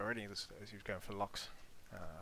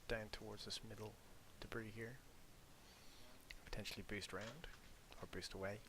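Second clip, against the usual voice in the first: whispering; 9 dB quieter than usual. Real, not acted. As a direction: keep it whispered so nobody hears. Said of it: "I have to whisper a little bit sometimes".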